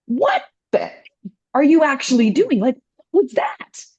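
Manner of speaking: high, outraged what-the; retelling her furious reaction at the stranger; indignant yell. Retold or imitated. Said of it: "I'm like".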